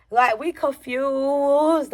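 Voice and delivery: drawn-out bafflement. Retold or imitated. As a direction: drag the word out in bafflement. Dramatizes the group's confusion.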